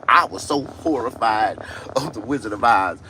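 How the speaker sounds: ordinary talk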